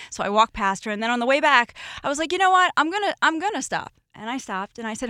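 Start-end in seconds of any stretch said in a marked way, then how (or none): 2.3-3.9 higher, quick change of mind; raised pitch, a little burst of decision; retold sudden resolve. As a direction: lightly act out deciding on the spot. Retells making up her mind.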